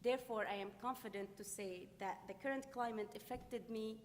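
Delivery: normal talking voice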